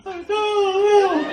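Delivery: high, slow, wailing sob through the words; ugly crying kid. Retold or imitated. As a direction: blubber it like a bawling kid. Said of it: "he's ugly crying".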